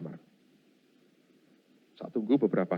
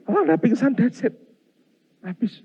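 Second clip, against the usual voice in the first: mock alarm; 10 semitones higher, 4 dB louder than usual. Real, not acted. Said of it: "mock fainting reaction".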